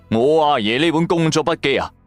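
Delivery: ordinary delivery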